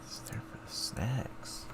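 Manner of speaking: soft unvoiced whisper. Real, not acted. Streamer mutters an aside to himself, whispering. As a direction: breathe it as a private aside. Aside while taking quiz.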